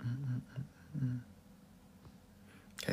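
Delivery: normal talking voice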